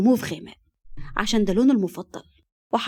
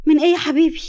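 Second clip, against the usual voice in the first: high, louder, doting question; coaxing concern; 7 semitones higher, 8 dB louder, faster than usual. Acted. Wife asks sulking husband on the phone, coaxing. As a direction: coo it to a sulking sweetheart.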